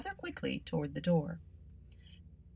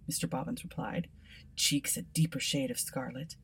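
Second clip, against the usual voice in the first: ordinary delivery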